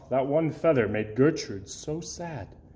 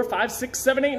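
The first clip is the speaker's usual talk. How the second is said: ordinary delivery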